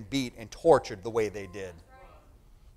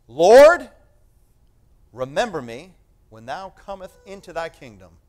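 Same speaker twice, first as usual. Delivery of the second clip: fervent scripture plea; 3 semitones higher, 13 dB louder than usual. Acted. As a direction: call out Lord, then plead. Reading the thief's plea.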